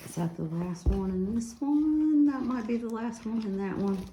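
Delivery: quiet aside to self. Real, not acted. Crafter checking her book, a soft muttered aside. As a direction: drop the voice, half to yourself. Wondering aloud to herself.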